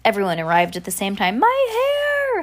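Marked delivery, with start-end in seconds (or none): 1.4-2.4 high, stretched cry of dismay; high acted yell. A narrator's reading. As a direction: wail it as a storybook girl. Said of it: "Heidi yelled".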